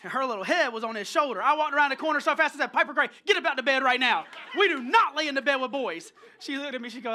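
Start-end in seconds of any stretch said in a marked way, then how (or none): 2.7-6.1 loud, mock-stern parental telling-off; retold dad scolding. Dad ordering his little daughter out of bed, loud scolding. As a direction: scold like an alarmed dad, loudly.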